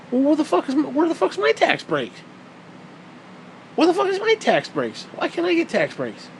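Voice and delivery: high-pitched mock indignation; mock outrage. Pretend would-be dog spouse demanding tax breaks, mock outrage.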